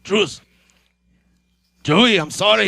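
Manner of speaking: put-on high, loud old men brawling; shouting geezer squabble; old man voice. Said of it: "as old men".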